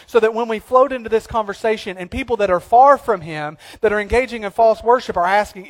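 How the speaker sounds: normal talking voice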